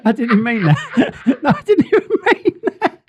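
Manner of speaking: high-pitched, laughing protest; helpless laughter. Real, not acted. Cracking up over the accent slip, helpless laughter. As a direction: squeal it out through real laughter.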